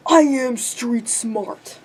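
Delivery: slow, drawn-out mock of her brother. Imitating her book-smart brother claiming to be street smart, slow mockery. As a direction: drag it out like a dopey retort.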